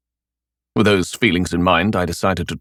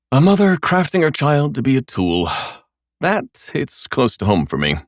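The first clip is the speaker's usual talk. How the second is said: ordinary delivery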